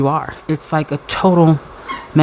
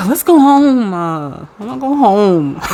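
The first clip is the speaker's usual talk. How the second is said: higher, slow fake crying whine; hammed-up crybaby whine; mock whimper; 5 semitones higher than usual. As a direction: pretend to sob in defeat. Mock defeat, pretend crying.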